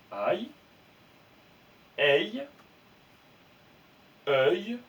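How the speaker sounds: aïe et œil lancés fort, un par un; lire les sons. Shown as a demonstration. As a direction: montrer chaque son à voix haute. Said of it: "devant le son Y". (aïe, œil dits fort et détachés)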